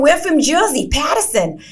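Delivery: pretending to be from New Jersey; mock New Jersey accent. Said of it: "talking in, like, New Jersey accents".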